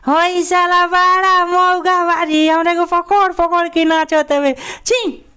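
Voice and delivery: high, slow falsetto, loud; shrill mocking falsetto, disgusted at the end; effeminate man voice. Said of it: "ये छक्का वाला जो आवाज़ होता है".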